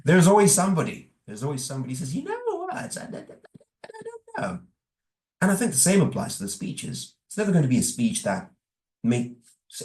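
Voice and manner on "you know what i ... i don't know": hesitant critic. Retold or imitated. Mimicking a doubtful feedback giver.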